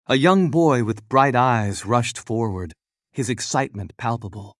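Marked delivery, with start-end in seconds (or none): none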